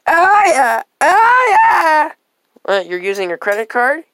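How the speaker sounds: high, loud whiny customer voice; high squeaky customer. Acted. Customer character voice.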